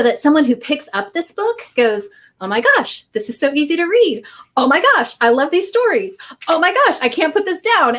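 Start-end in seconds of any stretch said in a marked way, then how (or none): 2.4-8.0 delighted reader. Retold or imitated.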